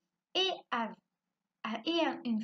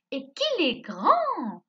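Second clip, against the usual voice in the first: loud, high, slow cry of wonder; exclamation; 6 semitones higher, 11 dB louder, much slower than usual. Shown as a demonstration. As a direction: exclaim it big, stretching the final word. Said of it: "terminer cette phrase avec un point d'exclamation".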